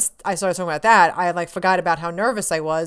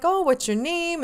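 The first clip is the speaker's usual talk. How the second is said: high, sing-song imitated greeting; Greg Miller's friendly interest; 10 semitones higher than usual. Star meeting his fan, friendly interest.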